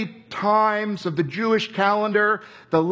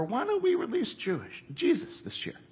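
softer and higher, a sly aside; sly hushed suggestion; 7 semitones higher, 9 dB quieter than usual. Retold or imitated. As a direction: float the idea quietly, like Pilate testing the crowd. Pilate slyly proposes releasing Jesus.

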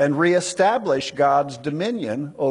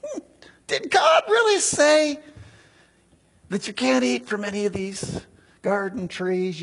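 high, sneering did God really say; mocking serpent; 6 semitones higher than usual. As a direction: sow doubt with a sly, incredulous sneer. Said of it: "And he's like".

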